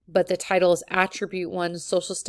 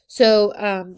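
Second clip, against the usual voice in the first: ordinary talk, nothing marked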